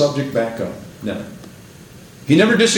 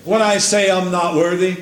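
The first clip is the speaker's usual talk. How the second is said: higher, fervent sermon climax; preaching at full force; 9 semitones higher, slower than usual. Acted. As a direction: build the refrain weep not to a shout.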